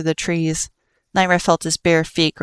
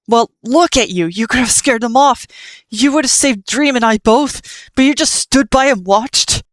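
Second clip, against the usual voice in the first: raised, accusing, higher and louder; angry accusation; 6 semitones higher, 4 dB louder than usual. Acted. Nightmare yelling at Frisk for just watching, angry. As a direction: berate him for standing by.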